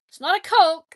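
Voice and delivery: Coke said high and loud; emphatic correction. Shown as a demonstration. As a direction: insist it's not a Coke. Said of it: "like you Southerners".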